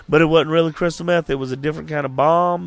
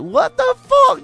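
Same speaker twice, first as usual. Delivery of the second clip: squeaky high voice, slow; high squeaky clown voice; 4 dB louder, slower than usual. Retold or imitated. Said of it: "I was like".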